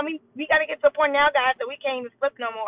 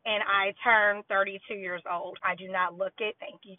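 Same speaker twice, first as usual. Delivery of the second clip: normal talking voice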